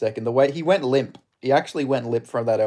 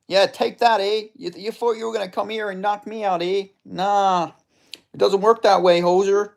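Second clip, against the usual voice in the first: higher-pitched hammed-up Canadian accent; Albertan hoser; 7 semitones higher than usual. Gamer voicing his fighter's taunts in a high Canadian accent. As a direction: play the fighter as a trash-talking Canadian hoser. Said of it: "I'm doing that voice for this guy".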